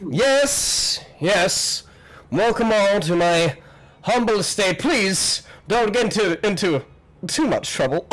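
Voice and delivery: high, fanciful host's greeting; welcoming host, grand. Acted. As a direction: welcome guests with fussy flourish. Voices the party's host.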